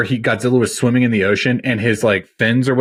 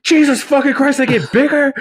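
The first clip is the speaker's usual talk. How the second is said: stunned; 13 semitones higher than usual. Retold or imitated. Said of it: "you're like".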